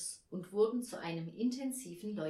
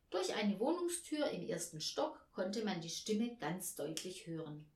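usual speaking voice

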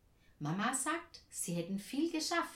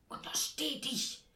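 sharp, high whispered hiss; hissed warning; 6 semitones higher than usual. A narrator's reading. Aunt forbids the child to open up, hissing. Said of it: "zischt Tante Ernie".

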